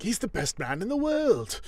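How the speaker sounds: high-pitched, hammed-up devotion; mock gushing admiration. Alan praising a friend he barely knows, mock gushing. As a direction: imitate him raving about his new friend. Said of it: "Alan's like".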